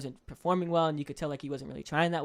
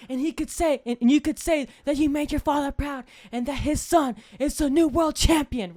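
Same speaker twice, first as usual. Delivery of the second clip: inspiring coach; 8 semitones higher, 3 dB louder than usual. Retold or imitated. Teddy Atlas inspiring a grieving boxer, lightly voiced. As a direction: sell the miracle to the fighter.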